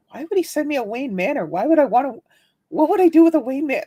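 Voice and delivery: high, baffled rising questions; stunned at the huge box, voice jumping up; confused disbelief. Retold or imitated. Man opening a surprise box, baffled and high. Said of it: "and I'm going".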